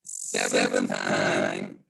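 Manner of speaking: muffled, distorted Zoom audio, low and dull; muffled mic. Real, not acted. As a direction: sound as if through a broken microphone. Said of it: "I'm coming in muffled".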